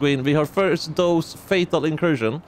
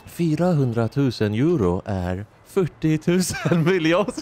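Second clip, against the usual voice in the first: quoting the meme; 3 semitones higher than usual. Retold or imitated.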